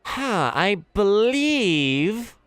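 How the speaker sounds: high, very slow, loud gnome musing. Acted. Gnome marvels that the half-orc knows Elvish, slow. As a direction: draw it out, amazed little gnome.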